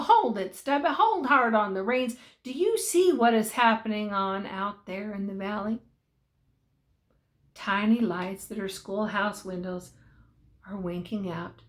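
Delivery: usual speaking voice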